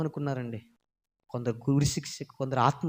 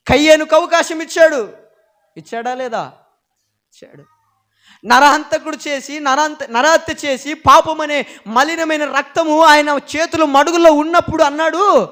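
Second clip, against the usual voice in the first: louder, higher pulpit preaching; fiery preaching; 12 semitones higher, 15 dB louder than usual. Acted. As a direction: drive it home to the congregation. Preaching at full force.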